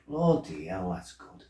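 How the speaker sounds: soft, half-whispered appreciation; hushed awe. Retold or imitated. Stoned lad quietly praising the joint. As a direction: breathe it out, savouring it.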